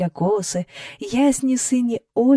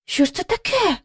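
високо, зі здивуванням; здивовано вигукни, дивлячись угору; вигук здивування; 7 semitones higher than usual. A narrator's reading. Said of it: "вигукнула вона". (здивований дівочий вигук)

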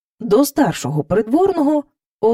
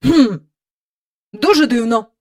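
pondering royal hum, slightly raised; puzzled king; 2 semitones higher, 4 dB louder than usual. A narrator's reading. King ponders the cow's problem, puzzled. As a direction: muse on it, puzzled. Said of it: "чухаючи потилицю".

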